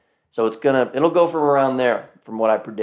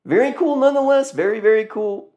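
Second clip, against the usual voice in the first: high enthusiastic; 11 semitones higher, 4 dB louder, faster than usual. Real, not acted. Enthusing over car.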